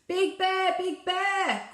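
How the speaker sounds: a little bear crying out for help; frightened cry. Acted. Little Bear calling to Big Bear, high frightened cry. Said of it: "cried Little Bear".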